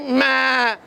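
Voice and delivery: long, put-on bleat; lamb bleat. Cub replies to Lion King, lamb bleat. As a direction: bleat back blankly like a lamb. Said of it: "lamb, sheep cub is like".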